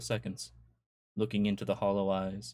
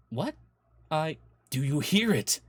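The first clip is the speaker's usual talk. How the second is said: louder, higher frantic shout; sudden shout; 7 semitones higher, 6 dB louder than usual. Acted. Husband frantically shouting at wife in the dark. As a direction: burst out at her, wild-eyed. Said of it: "Anthony shouted".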